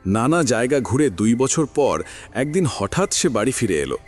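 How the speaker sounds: usual speaking voice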